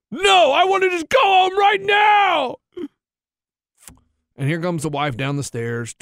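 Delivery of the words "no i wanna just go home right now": high, loud, slow mock wail; child's tantrum. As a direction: imitate a kid refusing, words dragged out. Retelling child's tantrum refusal.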